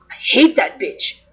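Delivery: slow hissed contempt; whispered, slow disdain; hissed insult. Retold or imitated. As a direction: spit it out under your breath. Paparazzo's blunt insult retold.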